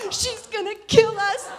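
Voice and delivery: mom crying in panic. Retold or imitated. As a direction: imitate a mother's frightened wail. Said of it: "my mom starts crying".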